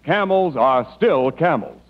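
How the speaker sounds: ordinary delivery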